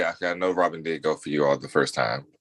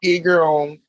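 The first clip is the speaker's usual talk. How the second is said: excited greeting; 10 semitones higher, 4 dB louder than usual. Real, not acted. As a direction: greet her with a big lift. Playful greeting to the princess.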